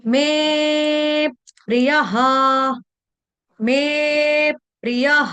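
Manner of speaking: मे प्रियः said slowly with the vowel stretched; drawn-out vowel. Shown as a demonstration. Teacher showing devotees how to draw out मे प्रियः. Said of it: "सुर को थोड़ा दीर्घ कर देना, खींचना है".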